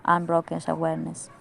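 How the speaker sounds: measured meditation guidance with long pauses; guided meditation. Acted. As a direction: lead them inward in even, deliberate phrases. Leads a recorded guided meditation.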